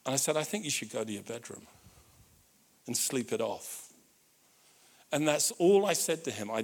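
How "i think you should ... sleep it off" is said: low, quiet restraint; subdued shock. Retold or imitated. Shocked father sending drunk son to bed, quiet restraint. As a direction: say it low and softly, without scolding.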